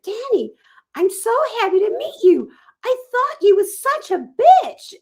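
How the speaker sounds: high, gushing mock voice of a fan; gushing conference woman. Conference woman blurting a backhanded compliment, high mock gush. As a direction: play the overeager woman who insults you sweetly. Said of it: "this woman walks over to me and she went".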